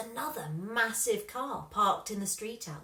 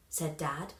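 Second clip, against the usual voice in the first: ordinary delivery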